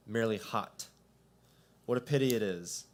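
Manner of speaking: usual speaking voice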